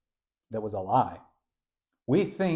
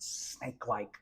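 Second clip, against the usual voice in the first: snake-like whisper; 2 semitones lower, 13 dB quieter, slower than usual. Shown as a demonstration. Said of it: "I'm doing it very nasally".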